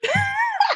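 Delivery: high-pitched squeal of laughter; shrieking laugh. Real, not acted. After confessing French is sexy, shrieking laugh.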